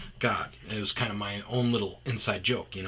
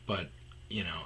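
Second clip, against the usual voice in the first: ordinary talk, nothing marked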